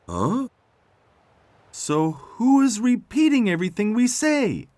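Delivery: very high, loud cartoon question; baffled tractor Tech. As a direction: ask in a high-pitched tractor voice.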